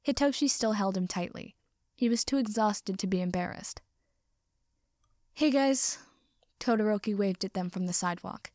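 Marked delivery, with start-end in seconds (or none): 5.3-6.0 friendly call. Acted. Friend calling from the sidewalk.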